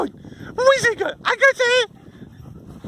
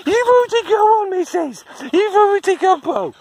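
mock scolding; 8 semitones higher than usual. Real, not acted. Telling greedy dog off playfully.

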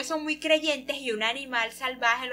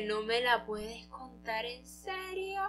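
slow, softer incredulous reaction; mock disbelief; 8 dB quieter, slower than usual. Bao hears his pursuer is at the market, slow disbelief. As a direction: drag it out softly in disbelief.

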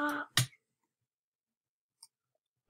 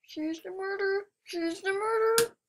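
high, repeated cries of alarm; alarmed warning; 5 semitones higher than usual. Pretend. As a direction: pitch it up in mock panic. Warning, murderer spotted.

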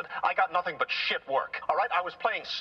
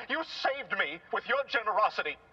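ordinary delivery

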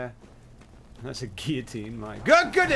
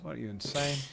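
ordinary talk

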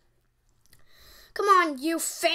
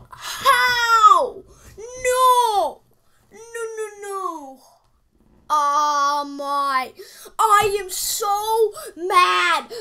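despairing whine; 5 semitones higher, 5 dB louder, much slower than usual. Real, not acted. Losing the match.

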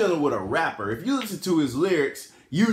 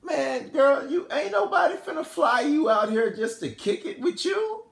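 high-pitched incredulous inner voice; imitated rapper's exasperated thought, pitched way up; 11 semitones higher than usual. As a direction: scoff in a high put-on voice. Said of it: "In his mind, [name]'s like".

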